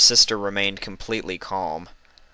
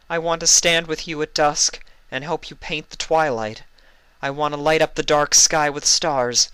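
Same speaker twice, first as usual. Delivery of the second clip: high, louder, dreamy longing; longing, rising joy; 8 semitones higher, 4 dB louder than usual. A narrator's reading. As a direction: let the wish lift the voice higher and brighter. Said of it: "Luna found herself smiling".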